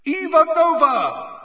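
high, loud, drawn-out announcer call; stadium announcer. A narrator's reading. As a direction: announce the team to the whole stadium. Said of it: "yelled Bagman".

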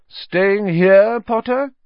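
a loud, high sneering call across the hall; mocking shout. A narrator's reading. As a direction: jeer at him loudly, high-pitched. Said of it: "shouted Malfoy".

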